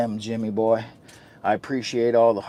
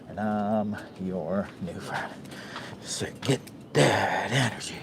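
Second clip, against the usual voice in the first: slow, stretched and a little softer; lightly voiced persona, taking his time; slow put-on voice; 2 semitones lower, 7 dB quieter than usual. Greeting new friends in a slow, softer put-on voice. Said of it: "What is wrong with his voice?".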